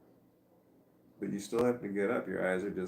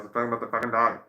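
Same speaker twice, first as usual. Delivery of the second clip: much louder stern repeat, no laugh; now mean it, bark it out louder; mock scold; 2 semitones higher, 10 dB louder, much faster than usual. To herself about the sleeping dog, loud mock scold.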